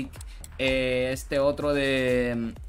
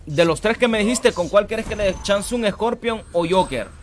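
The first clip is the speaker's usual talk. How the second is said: voz alta y aguda, burlona; voz aguda de personaje; 7 semitones higher, 3 dB louder than usual. Pretend. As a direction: juega a ser otro, con voz chillona. Habla como Pato Montiel. (saluda a Pato Montiel con voz de personaje)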